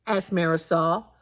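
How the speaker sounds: usual speaking voice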